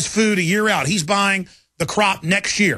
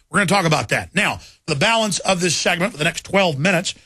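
usual speaking voice

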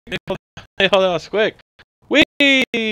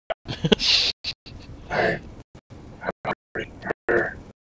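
breathy rasping ghoul laugh; wheezy hissing laugh; ghoul cackle; 8 dB quieter than usual. Acted. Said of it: "do a ghoul voice".

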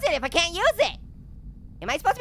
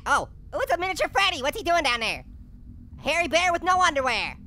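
normal talking voice

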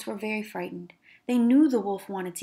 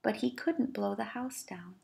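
whispered narration; storyteller whispering the failure; 9 dB quieter than usual. A narrator's reading. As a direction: hush it for suspense. Dramatic narration after huffing.

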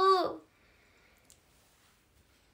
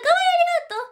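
delighted squeal; 11 dB louder, much faster than usual. Real, not acted. Delighted thanks to viewer.

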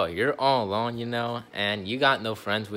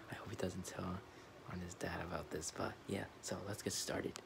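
very soft, whispered aside to viewers; whispering; 3 semitones lower, 18 dB quieter than usual. Real, not acted. Vlogger hopes friend won't tell his dad, whispering. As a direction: whisper it so he can't hear. Secret prank, Kevin in earshot.

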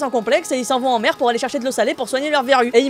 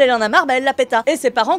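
ordinary delivery

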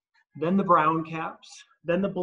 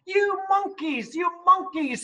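much higher, put-on angry peddler; scolding the monkeys; 14 semitones higher than usual. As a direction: scold them with a raised fist. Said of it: "shaking a fist at them".

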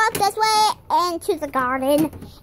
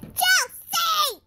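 mock yell; 7 semitones higher than usual. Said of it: "really loud".

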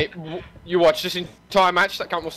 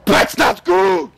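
slow, drawn-out shout, much higher and louder; alarmed shout; 11 semitones higher, 7 dB louder, much slower than usual. Real, not acted. Gamer shouting to teammates, slow drawn-out alarmed yell. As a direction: yell it out as things go wrong. Reacting to a bad in-game moment.

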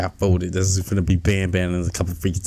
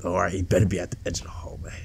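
whisper; 2 semitones lower, 5 dB quieter, faster than usual. Real, not acted. Sneaking past a monster.

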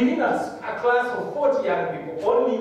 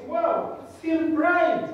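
high, slow amazement; surprised; 12 semitones higher, much slower than usual. Retold or imitated. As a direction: marvel that the shirt still shows. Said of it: "I could see".

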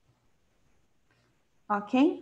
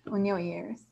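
whisper; 4 dB quieter, slower than usual. Real, not acted. Aside to class.

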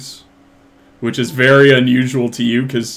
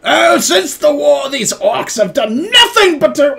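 blustering tavern dwarf rant; ranting drunken dwarf; 14 semitones higher, 7 dB louder than usual. Acted. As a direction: bellow like an old war veteran dwarf over his ale. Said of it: "you can hear him just going".

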